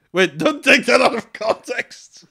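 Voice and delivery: high, loud, drawn-out exclamation; loud laughing protest. Real, not acted. Reader heading off co-host after an awkward quote, loud protest. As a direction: shout it in amused alarm at your own words.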